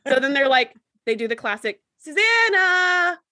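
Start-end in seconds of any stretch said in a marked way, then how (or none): 2.0-3.2 drawn-out call across a distance; slow, high calling for a missing crewmate. Retold or imitated. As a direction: call her name out slowly, pitched up. Away team calling out.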